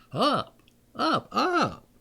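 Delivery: a hammed-up yell at the cows; mild mock scream. A narrator's reading. Shepherd urging weak cows up, mild mock scream. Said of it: "screamed he".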